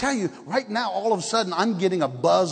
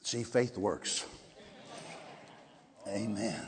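very soft, low and slow; hushed reverence; 10 semitones lower, 16 dB quieter, slower than usual. Real, not acted. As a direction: murmur quiet thanks in prayer. Hushed thanks closing the story.

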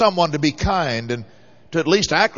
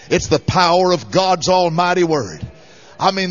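usual speaking voice

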